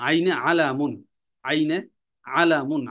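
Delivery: ordinary delivery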